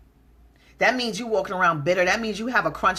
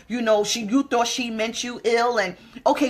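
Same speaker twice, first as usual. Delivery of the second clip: normal talking voice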